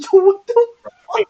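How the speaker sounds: high, loud cry of disbelief; retold panicked shout; shocked yell. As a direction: yell it out as if being chased. Retelling his shock at the chase.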